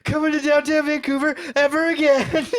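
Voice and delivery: high, whining mock complaint; whiny vow. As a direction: whine it like an upset friend. Kiona vowing never to return.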